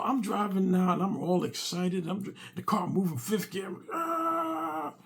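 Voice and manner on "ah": high startled yelp; startled cry. Retold or imitated. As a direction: yelp as if jolted awake. Wife wakes mid race.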